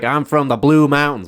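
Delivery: high mock Southern accent. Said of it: "It's a Southern accent".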